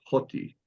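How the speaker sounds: "hoti" with a breathed H in front; aspirated H. Shown as a demonstration. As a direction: say "hoti" as HOT-ee, H sounded. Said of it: "If it's cupped".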